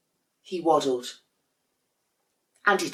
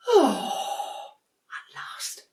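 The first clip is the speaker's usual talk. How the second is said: a sigh then a whisper; weary whispered relief; 9 semitones higher, much slower than usual. Acted. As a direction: breathe it out, worn out. Exhausted relief alone.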